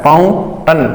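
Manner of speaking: फाउंटन ending in a neutral "टन"; correct "tan" ending. Shown as a demonstration. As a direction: say फाउंटन with a schwa in the last syllable. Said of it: "फाउंटन होता है". (फाउंटन के अंत में "टन" बोलें)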